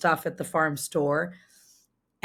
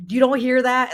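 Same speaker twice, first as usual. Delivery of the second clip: louder, higher mock-exasperated question; retelling a husband's irritated question, louder and higher; imitating irritated husband; 6 semitones higher, 6 dB louder than usual. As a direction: toss it back at her, annoyed and louder. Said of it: "And he was like".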